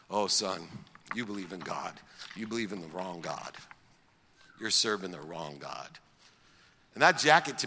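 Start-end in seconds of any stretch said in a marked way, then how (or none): 0.1-6.0 lower, softer mother's voice; mother to her son, lower and soft; gentle mother. Retold or imitated.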